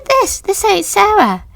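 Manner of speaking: frantic insistence. Retold or imitated. Girl insisting to the others the ghost isn't Sarah, high urgent cry. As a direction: push it out high and urgent, begging them to see.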